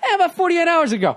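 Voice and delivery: high, laughing send-up of the comedian; mock admission. Host teasing the comic about snapping, high mocking admission. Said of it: "He's doing this whole thing".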